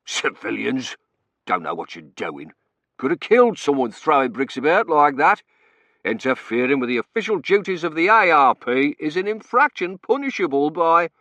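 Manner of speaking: loud official grumbling, rising into a tirade; indignant warden. A narrator's reading. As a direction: scold meddling civilians as an officious warden. Warden scolds civilians who rescued him.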